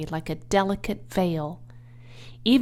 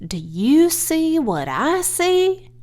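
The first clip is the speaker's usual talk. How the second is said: higher, slow awestruck question; awed; 8 semitones higher, 5 dB louder, slower than usual. Acted. Lurvy shows the boss the web, slow and awed. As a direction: ask it slowly, spooked.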